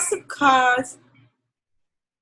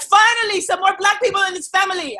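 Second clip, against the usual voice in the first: excited relative; 6 semitones higher than usual. Retold or imitated. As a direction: cheer it out like a delighted uncle. Relative voiced welcoming her.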